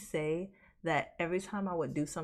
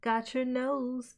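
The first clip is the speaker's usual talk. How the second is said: put-on high, slow playful tease to a kid; teasing a little kid; got your nose tease; 8 semitones higher, 3 dB louder, much slower than usual. Pretending to steal kid's nose, sing-song tease. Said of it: "you be like".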